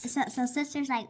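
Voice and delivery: plain reading voice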